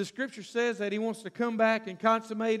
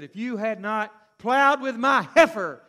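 slow angry retort; 7 dB louder than usual. Acted. Samson answering the soldiers.

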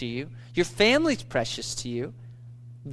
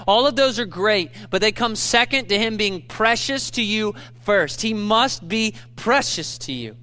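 raised, insistent pulpit voice; emphatic preaching; 4 semitones higher, 3 dB louder than usual. Acted. Preacher pressing the church to put Christ first, emphatic. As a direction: drive each "not" home to the congregation.

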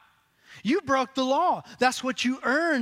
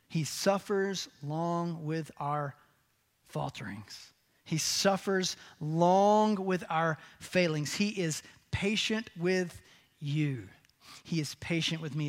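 tender; 5 semitones lower, 5 dB quieter than usual. Real, not acted. Tender passage on God's patience.

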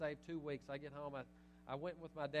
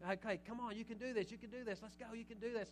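higher, coaxing cheer to keep going; egging on; 5 semitones higher than usual. Shown as a demonstration. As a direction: nudge them on like a coach cheering. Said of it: "It means to egg on".

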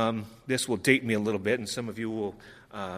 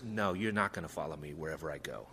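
softer and lower, a firm quiet rebuke; quiet harsh rebuke; 8 semitones lower, 9 dB quieter, faster than usual. Retold or imitated. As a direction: cut him down quietly and lower. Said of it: "Jesus is harsh with him".

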